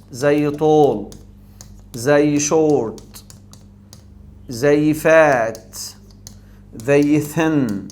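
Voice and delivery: each word drawn out slowly in one breath; slow one-syllable demo. Shown as a demonstration. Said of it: "اي تنطق على نفس واحد". (نطق بطيء لكل كلمة في نفس واحد)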